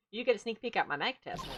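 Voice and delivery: usual speaking voice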